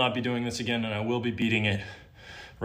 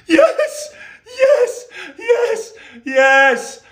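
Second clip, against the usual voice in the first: high, loud triumphant yelling; excited cheering; 9 dB louder, much slower than usual. Real, not acted. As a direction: cheer victory wildly. Celebrating finishing the challenge.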